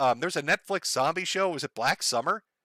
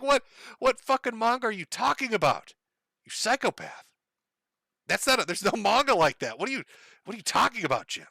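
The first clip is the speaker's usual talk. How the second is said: incredulous friend; 7 semitones higher than usual. Pretend. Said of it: "They'll be like".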